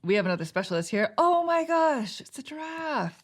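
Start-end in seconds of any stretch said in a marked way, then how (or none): 1.2-3.2 high, drawn-out delighted gasp of recognition; delighted surprise. Real, not acted. Player spots a giraffe on the new card, delighted surprise. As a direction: gush in a high voice, stretching it out.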